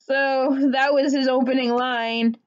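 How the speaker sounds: ordinary talk, nothing marked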